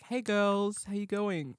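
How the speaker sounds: chirpy greeting. Retold or imitated. Chirpy greeting to drama girls.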